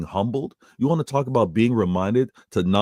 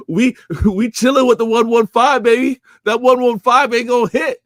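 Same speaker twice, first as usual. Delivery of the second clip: higher and louder, gloating hype; hyped boast; 12 semitones higher, 7 dB louder than usual. Real, not acted. Host gloating to chat about his show, hyped. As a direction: crow about the show with a laugh in it.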